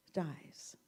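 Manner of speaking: ordinary talk, nothing marked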